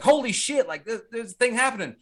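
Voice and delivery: hammed-up startled exclamation; mock astonishment. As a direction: act out a scientist's shock. Voices the startled scientist.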